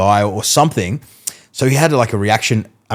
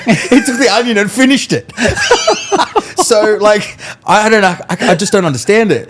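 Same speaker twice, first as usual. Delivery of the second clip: laughing; 12 semitones higher, 4 dB louder than usual. Real, not acted. Laughing about hypnotized guy.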